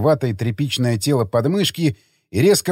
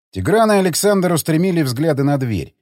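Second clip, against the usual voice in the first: plain reading voice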